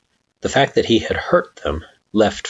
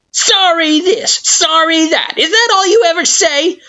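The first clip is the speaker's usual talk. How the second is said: mocking sorry; 5 dB louder than usual. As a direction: parrot the word back with contempt. Flowey mocking the apology.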